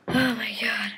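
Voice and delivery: whispered disbelief. Real, not acted. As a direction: breathe it out under your breath. Whispering to himself, laughing.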